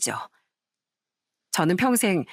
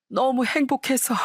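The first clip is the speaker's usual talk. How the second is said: higher and slower, swept up in tearful joy; overcome with joy; 6 semitones higher than usual. Acted. Father repeating to his daughter that he is happy, higher, slower, tearful. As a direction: let the words break open, slowly.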